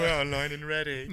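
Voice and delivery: laughing while mocking the line; mock robot assistant through laughter; laughing imitation. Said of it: "mocking the Jarvis line".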